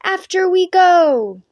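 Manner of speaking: loud, high, slow war cry; shouted. Acted. Knights shouting their ranger cry, loud. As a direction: throw your head back and yell it. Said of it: "They shouted".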